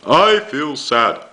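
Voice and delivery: high, slow, hammed-up wail. Shown as a demonstration. Teacher showing Year Three how Bear wails, hammed-up wail. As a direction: wail the line like a crying toy bear. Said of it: "wailed Bear".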